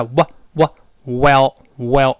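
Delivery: "哇，哇" slowly with lips pressed and snapped apart, then "well，well"; lip-popping W. Shown as a demonstration. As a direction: exaggerate the W lips. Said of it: "双唇碰撞，立刻分开".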